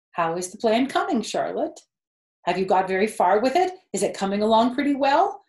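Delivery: eager piglet firing questions at the spider; anxious rush. Acted. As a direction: rush the words out in a nervous burst. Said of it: "Wilbur was trembling again".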